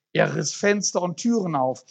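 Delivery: lively storytelling read to the congregation; story read aloud. A narrator's reading. Preacher reading a parable to the church, lively storytelling. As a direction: read the story with animated character voices.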